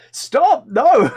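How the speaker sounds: high, loud flustered protest; embarrassed yell. Real, not acted. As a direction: shout stop in mock panic. Protesting chat's zombie requests.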